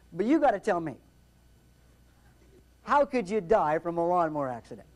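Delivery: ordinary talk, nothing marked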